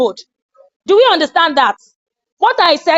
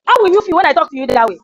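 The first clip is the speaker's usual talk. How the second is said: ordinary delivery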